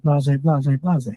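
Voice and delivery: ordinary talk, nothing marked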